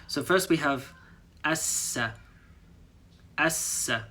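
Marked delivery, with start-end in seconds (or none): 1.4-4.1 "assa" with the S held long; "assa": linger on the double S. Shown as a demonstration. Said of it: "you hold on this two S sound a little bit longer".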